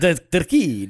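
loud, fumbled try at an Italian "decreed"; failed Italian accent. Shown as a demonstration. Copying the co-host, botched Italian accent. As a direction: attempt a rolled R in "decreed" and miss. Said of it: "I can't do it".

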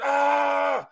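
long, high imitated effort cry; lifting yell. Mad lifter pushing the bar, imitated high yell. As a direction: hold a high yell through the rep.

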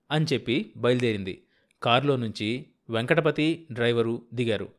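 ordinary delivery

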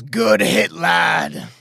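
put-on loud, high, grizzled old captain; grizzled talking tree. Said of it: "accent is just, grizzled".

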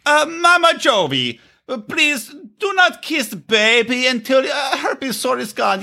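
high, slow halting voice, imitated; blunt mock Yara, high and slow; imitating Yara. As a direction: put on Yara's blunt voice to the mother-in-law. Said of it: "I just wanted Yara to go".

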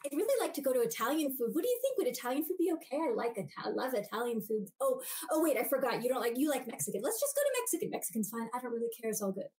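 mock self-erasing chatter, backing down from Italian food; people-pleasing backpedal. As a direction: keep caving to the other person's choice. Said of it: "Or we do the opposite".

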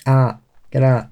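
"out" with an open vowel, near "at"; own-accent pronunciation. Shown as a demonstration. Showing co-hosts how we say "Get out", open-vowelled. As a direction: demonstrate "Get out" in the home accent. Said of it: "That's how we pronounce out".

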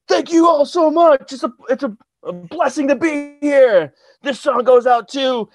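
high, loud froggy singer thanking the crowd; Kermit the Frog voice. Retold or imitated. Singer thanking an empty crowd, mock Kermit voice. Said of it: "in the Kermit the Frog voice".

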